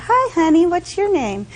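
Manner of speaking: put-on high, sing-song baby talk to a stranger's infant; cooing at a baby. Man leaning over to greet the nursing baby, high cooing. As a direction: lift the pitch and coo at the little one.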